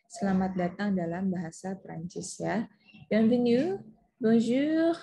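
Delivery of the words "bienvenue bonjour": Bienvenue, bonjour said louder as a clear French model; teacher models Bienvenue, bonjour for students in French; French model pronunciation. Shown as a demonstration. Teacher demonstrating French greeting pronunciation. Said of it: "Saya akan mencontohkannya".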